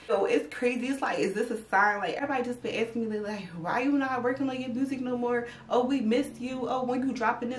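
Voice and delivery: normal talking voice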